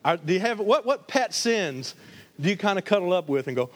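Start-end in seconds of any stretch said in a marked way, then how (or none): none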